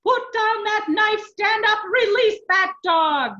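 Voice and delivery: high, full-force slow shout; bellowed command. A narrator's reading. Furious monk orders the abbot to stop, bellowing. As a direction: roar each order commandingly. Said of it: "Michelangelo bellowed".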